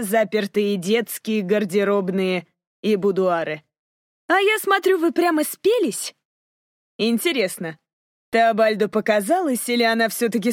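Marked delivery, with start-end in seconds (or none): 4.3-6.1 put-on young lady voice. A narrator's reading. Narrator voices Cassandra.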